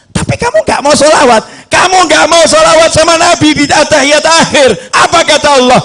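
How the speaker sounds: high, insistent sermon delivery; accusing preacher in full flow; full-force preaching. Acted. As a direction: confront the congregation with it.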